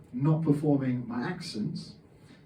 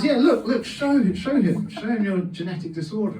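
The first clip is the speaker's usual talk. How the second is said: higher, louder pushy dad voice; dad's eager urging; 6 semitones higher, 4 dB louder than usual. Retold or imitated. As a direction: play the proud pushy father, egging him on. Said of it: "And he says".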